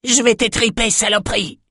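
high, furious bawl of a warrior woman; bawled threat. Acted. Raging Norokai bawls a threat at the queen. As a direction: spit the threat out, pitched high. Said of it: "brailla-t-elle".

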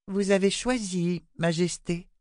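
lightly voiced girl, cold and firm. A narrator's reading. Girl coldly condemning the queen. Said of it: "lâcha froidement Rachel".

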